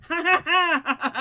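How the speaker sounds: loud hammed-up evil cackle; mock villain laugh. Gamer cackling in triumph after catching a bat.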